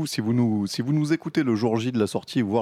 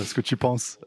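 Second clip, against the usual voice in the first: usual speaking voice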